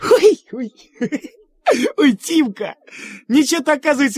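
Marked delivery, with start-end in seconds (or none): 0.0-2.8 giggling with surprise; laughing. Acted. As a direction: burst into laughter. Friend bursts out laughing.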